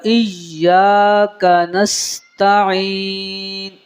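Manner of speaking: slow, chanted tajweed with long held vowels; Quranic recitation. Shown as a demonstration. Teacher recites for students, slow melodic Quranic chant. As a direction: intone each phrase, sustaining the endings.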